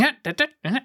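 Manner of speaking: high, sing-song da-da-da; Sharpay inflection. Retold or imitated. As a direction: mimic her lilting whine. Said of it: "she's going".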